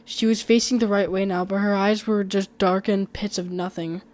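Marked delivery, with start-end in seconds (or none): none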